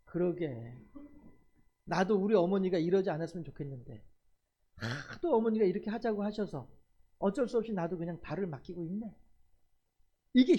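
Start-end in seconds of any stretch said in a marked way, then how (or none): 0.1-9.1 soft resigned reply. Retold or imitated. Son answers gently, resigned.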